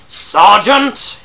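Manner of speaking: loud urgent call of Sergeant; a full-throated shout; crying out. Acted. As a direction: shout it across the green. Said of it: "he cried".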